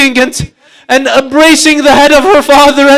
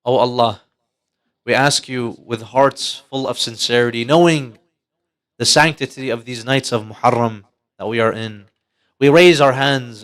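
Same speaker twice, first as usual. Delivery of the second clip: heartfelt prayer; 12 semitones lower, 8 dB quieter than usual. Acted. Preacher leads the congregation in a solemn low prayer. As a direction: plead earnestly with God, low and unhurried. Leads supplication after the story.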